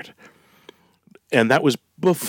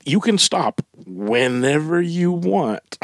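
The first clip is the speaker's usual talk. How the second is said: slow, high mock denial; mock addict; 6 semitones higher, slower than usual. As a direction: fake junkie excuse. Mock addict excuse line.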